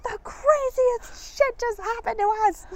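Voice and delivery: high, slow, thrilled announcement; breathless excited retelling. Retold or imitated. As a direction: burst out with the crazy news. Excitedly telling friend news.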